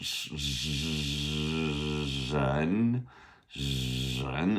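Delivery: "sh-, sh-, zhen" gliding from a long hush into a buzzed initial; soft, drawn-out "sh-" melting into "zhen"; SH meeting R. Shown as a demonstration. Teacher breaking down a difficult initial, SH meeting R. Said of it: "like an S H meets an R".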